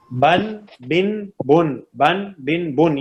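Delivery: measured teacher's drill, rotating a, i, u in بن; each بن closed on a clear n; tanween drill. Shown as a demonstration. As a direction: chant بن slowly as ban, bin, bun. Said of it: "یہ sound ہوتی ہے".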